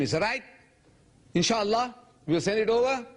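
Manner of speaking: high-pitched easy promise, rising at the end; mock reassurance. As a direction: imitate someone brushing off a worry in a high lilt. Imitates people's breezy promises.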